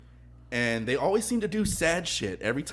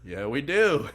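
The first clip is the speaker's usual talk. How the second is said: upbeat reply; 4 semitones higher, 5 dB louder, slower than usual. Retold or imitated. Waiter's reply to wine-deal question.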